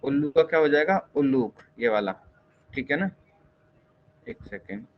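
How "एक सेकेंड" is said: whispered under the breath; a quick whispered one second; whispered aside. Real, not acted. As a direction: mutter it to yourself while checking. Aside while checking answer.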